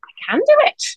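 light up as it clicks; sudden realisation. Retold or imitated. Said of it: "I woke up and I went".

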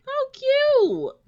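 very high mock coo; sarcastic high-pitched how cute. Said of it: "And I was like".

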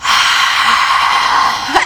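long unvoiced ghostly rattle; eerie breathy moan. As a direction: breathe out a creepy death rattle. Eerie noise heard again.

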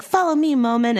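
high excited call to a friend. Retold or imitated. Child self calling friend.

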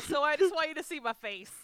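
loud, high, talking through real laughter; laughing. Real, not acted. Telling a friend about the face mask photo, laughing hard. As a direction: push the words out while cracking up.

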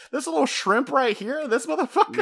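high, laughing mock taunt; cartoonish high voice teasing a little kid; mocking Luffy. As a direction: tease the kid through laughter. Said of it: "making fun of him, going like".

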